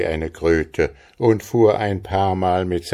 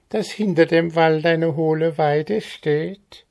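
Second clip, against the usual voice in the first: high squawking goblin voice full of scorn; schnarrender Kobold; 11 semitones higher than usual. Acted. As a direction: sneer down at the simple farm lad as a goblin. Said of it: "schnarrte er ihn an". (hohe, höhnisch quäkende Koboldstimme)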